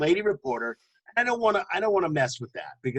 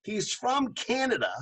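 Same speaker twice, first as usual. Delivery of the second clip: ordinary delivery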